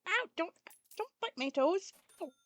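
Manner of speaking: yelped complaint at being bitten; pained protest. Real, not acted. Kitten biting the speaker's toes, pained protest.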